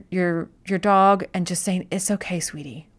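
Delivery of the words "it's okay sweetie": soft soothing. Retold or imitated. Comforting dog as analogy.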